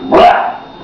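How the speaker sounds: ordinary delivery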